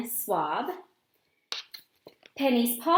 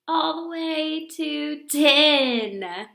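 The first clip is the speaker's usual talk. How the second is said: slow, louder, stretched ending; drawn-out delight; 4 semitones higher, 6 dB louder, much slower than usual. Acted. Girl marvelling at a long dog tail, slow and louder. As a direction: savor the big count.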